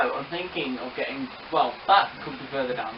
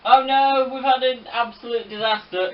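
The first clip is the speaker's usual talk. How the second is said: high, loud "oh no" of alarm; alarmed cry; 8 semitones higher, 7 dB louder than usual. Real, not acted. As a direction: cry out at the crash in dismay. Train crash mock horror.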